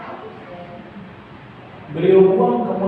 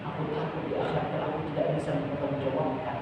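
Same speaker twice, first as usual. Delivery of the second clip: much softer, trailing to a whisper; hushed fear; 3 semitones lower, 11 dB quieter than usual. Retold or imitated. Umar confessing his fear of the afterlife, hushed. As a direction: let the fear of judgment sink the voice.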